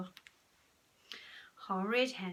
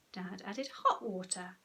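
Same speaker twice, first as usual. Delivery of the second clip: ordinary delivery, nothing marked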